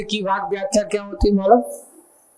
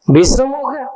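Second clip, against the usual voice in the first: mocking; 8 semitones higher, faster than usual. Mocking the listener's view.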